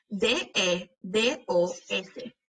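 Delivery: slow, letter by letter; name each letter separately; spelling out. Shown as a demonstration. Teacher spelling dedos for pupils, letter by letter. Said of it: "cuando estamos deletreando estas palabras".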